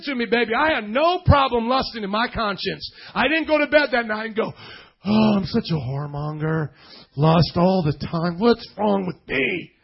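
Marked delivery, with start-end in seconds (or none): 5.0-9.7 slow, low hammed-up self-pity; mock remorse. Preacher mimicking a guilty sinner, mock remorse. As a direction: wallow in fake guilt, drawn out.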